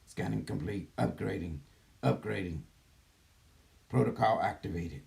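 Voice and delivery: calm computer system announcing steps; gentle care robot. Acted. Channeler switches into computer voice.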